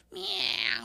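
high cat meow. Acted. Cat luring mouse.

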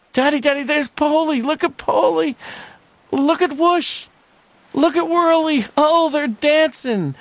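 put-on high, loud little boy pointing things out; excited child. Son showing his father the dancing bears, high and loud. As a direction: call out each bear like a delighted kid.

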